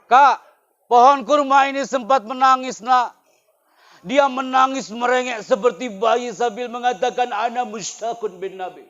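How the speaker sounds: loud, high, impassioned sermon; loud, high storytelling sermon about the weeping palm; full-force preaching. Acted. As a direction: preach it with fire to the students.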